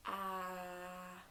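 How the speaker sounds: quiet drawn out Ah; soft model sound. Shown as a demonstration. Teacher models the letter sound softly. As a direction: breathe the sound gently. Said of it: "Entonces es s".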